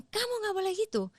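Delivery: high, stern telling-off; scolding parent. Retold or imitated. Parent telling the child off, high and stern.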